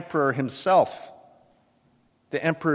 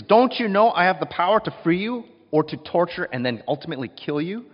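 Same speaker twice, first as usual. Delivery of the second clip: higher and louder, exasperated threat; losing his temper; 6 semitones higher, 6 dB louder than usual. Acted. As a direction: Pilate snaps at the silent prisoner. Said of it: "Pilate loses it".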